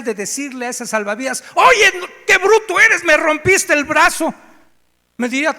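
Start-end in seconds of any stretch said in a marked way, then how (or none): 1.5-4.3 much higher and louder, outraged; ungrateful man yelling at lifeguard; indignant complaint. Pretend. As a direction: scold him for the broken arm.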